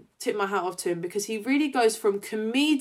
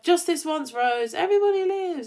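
higher, joyful Doctor quote; imitating Ten; 7 semitones higher than usual. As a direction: beam it out like a triumphant Doctor. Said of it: "when he's like".

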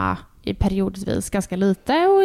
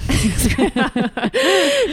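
real burst of laughter; amused laugh after a joke; 3 semitones higher, 3 dB louder than usual. Real, not acted. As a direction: crack up at your own joke. Laughs at her own joke.

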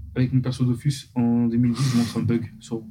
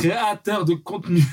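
sarcastic air quotes, slow and higher; mock the title, slow and raised; 6 semitones higher, slower than usual. Real, not acted. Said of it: "Je fais les guillemets avec mes doigts".

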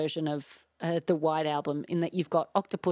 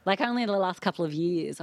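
ordinary talk

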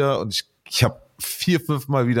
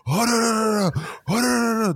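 high-pitched imitation of a furious Turkish tirade; mock shouting fit, pitched way up; retold Turkish ranting; 11 semitones higher, much slower than usual. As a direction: mimic someone yelling curses in Turkish, high and drawn-out. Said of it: "Er fängt richtig an zu schreien auf Türkisch".

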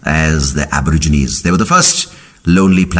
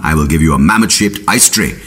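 ordinary delivery

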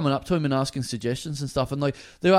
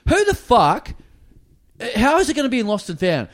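mock incredulous; 3 semitones higher, 4 dB louder than usual. Incredulous retold retort.

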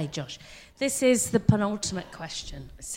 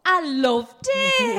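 put-on Northern accent; 8 semitones higher, much slower than usual. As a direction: ham up the Northern accent, high and drawn out. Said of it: "Do they have to do it in the Northern accent?".

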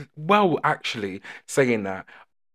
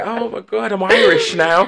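slow, higher, hammed-up giddy voice; tipsy convert; 7 semitones higher, 5 dB louder than usual. After two Guinnesses, mock giddy and slow. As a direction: ham up a tipsy new Irish fan, lightly. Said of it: "you say".

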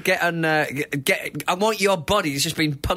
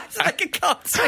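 laughing; 10 semitones higher than usual. Real, not acted. Laughing at a grim story.

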